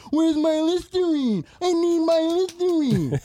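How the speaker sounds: high, slow, whiny mock customer; mock a needy customer whining. Owner mocking customers who email about orders, high whine. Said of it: "You don't see me emailing Amazon".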